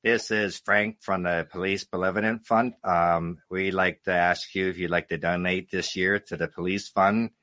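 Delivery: low-pitched American fundraiser impression; American caller. Russian comic imitating an American police fund caller, low American accent. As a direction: drop low for an American phone solicitor's accent. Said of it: "I imitate Americans".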